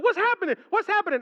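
high, loud panicked cry; frantic new dad yelling for answers. Retold or imitated. Husband in the delivery room, panicked high shout. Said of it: "I was like".

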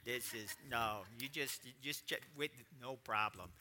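low, soft, easy reassurance; laid-back veteran pilot calm; calm and unbothered. Retold or imitated. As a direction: shrug it off quietly. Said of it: "and I go".

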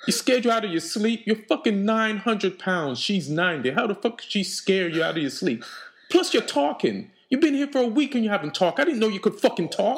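high, exasperated rant; worked-up, indignant telling-off; incredulous scolding. Acted. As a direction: tell off a pet in disbelief. Berates the dragon.

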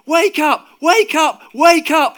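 frantic high, loud shouts; full-force repeated wake-up shout; all-out yell. A narrator's reading. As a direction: yell with all you have. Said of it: "he yelled with all he had".

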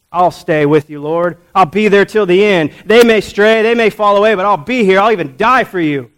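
acted loud, impassioned promise of loyalty; bold vow. Disciple swearing loyalty to his Lord, bold and loud.

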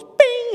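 sudden high squeaky bing; cupid arrow bing. Pretend. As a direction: zap it out like a cartoon arrow hit. Cupid's arrow sound effect.

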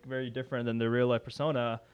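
normal talking voice

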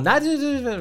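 high-pitched mock cry of protest; mock yell. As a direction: yell it up high like she's panicking. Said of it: "så råber hun".

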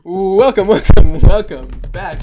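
big booming welcome; loud podcast intro. Acted. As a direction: announce it loudly to the listeners. Opening the podcast episode.

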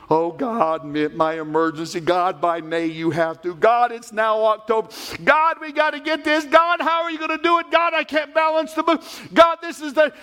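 rising, high panicked pleading to God; frantic prayer. Retold or imitated. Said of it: "we put all of our emergencies".

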